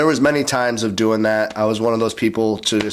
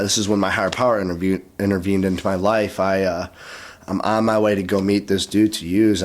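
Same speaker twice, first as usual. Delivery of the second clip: normal talking voice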